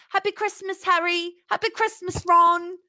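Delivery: high, chirpy film-quote voice; bright iconic film greeting; Harry Potter line. Retold or imitated. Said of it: "it's an iconic thing".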